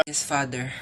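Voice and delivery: soft low Yes Father; hushed church reply. Acted. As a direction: murmur the response at mass. (mahinang sagot sa misa)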